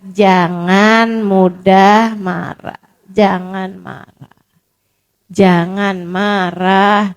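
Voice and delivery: very slow and low, each word weighed; slow solemn counsel. Retold or imitated. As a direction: draw out the advice gently. Solemn repeated advice, very slow.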